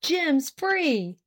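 high, glad call. A narrator's reading. Farnsworth waving to Miss Ware, calling out. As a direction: call the news across to her. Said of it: "waved his hat and called".

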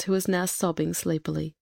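ordinary delivery

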